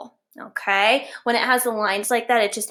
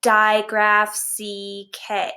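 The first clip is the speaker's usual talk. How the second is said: measured, spaced-out recital for repeating; slow model phrase; 2 semitones lower, slower than usual. Shown as a demonstration. Teacher modelling what the class is going to say, slow. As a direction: say it slowly, each part separate. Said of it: "we're going to say".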